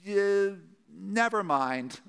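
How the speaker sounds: high, stalling, awkward retraction; sheepish backing down. Acted. Elders telling an army ready for war to go home, sheepish. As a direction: draw it out like an embarrassed climb-down. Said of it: "go back and say to them".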